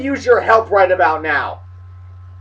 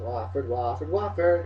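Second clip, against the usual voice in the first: very low, soft, drawn-out "Wofford"; savoring the word; 11 semitones lower, 6 dB quieter, slower than usual. Real, not acted. Looking up Wofford and enjoying the name, savoring it. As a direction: roll "Wofford" around slowly and quietly. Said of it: "That's fun to say".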